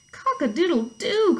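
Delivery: loud, high, drawn-out crowing; playful imitation of a crowing rooster. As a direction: crow like a storybook rooster. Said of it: "crowed Rooster".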